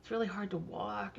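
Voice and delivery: lightly voiced complaint about a sore hip; sore old woman. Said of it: "she was like".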